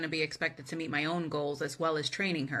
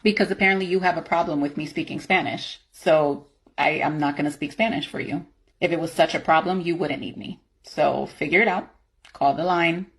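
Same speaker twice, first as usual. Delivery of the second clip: angry; 9 dB louder than usual. Acted. Refuses Spanish call, heated argument.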